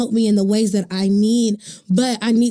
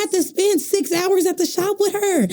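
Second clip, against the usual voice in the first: realizing aloud; 8 semitones higher than usual. Retold or imitated. Telling friends how I realized, higher dawning voice. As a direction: voice rising high as it clicks for me. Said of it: "I was like".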